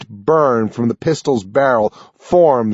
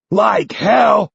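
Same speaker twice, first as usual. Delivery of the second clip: higher, louder retort; defiant shout; 9 semitones higher, 3 dB louder than usual. A narrator's reading. Nine refusing to accept the old man's fate, shouting. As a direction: spit it back in defiance.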